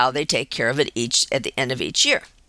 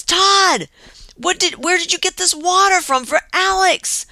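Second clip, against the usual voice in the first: alarmed demand; 13 semitones higher, 3 dB louder than usual. Retold or imitated. Daughter confronting Todd about water.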